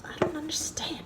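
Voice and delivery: whispered to herself, baffled; puzzled whisper. Real, not acted. Baker whispering over runny batter, puzzled. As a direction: mutter it under your breath, confused.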